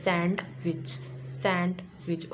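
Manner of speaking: सैंडविच with the D sounded, SAND-WICH; common mispronunciation. Shown as a demonstration. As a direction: split सैंडविच after a clear D. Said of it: "और इसे क्या कहते हैं".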